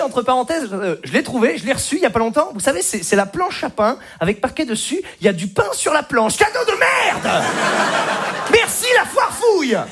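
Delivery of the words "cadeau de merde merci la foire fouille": high, raging yell. Acted. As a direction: blow up and yell at the gift. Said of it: "j'avais envie de gueuler".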